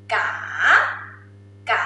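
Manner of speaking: "ka" dipping, then sweeping up; rising tone. Shown as a demonstration. Teacher reading the marked word, rising-tone "ka". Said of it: "And rising".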